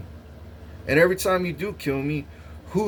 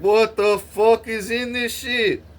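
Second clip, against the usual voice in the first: slow, higher disgusted disbelief; 7 semitones higher, slower than usual. Retold or imitated. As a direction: stare at the meat in horror. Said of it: "I'm like".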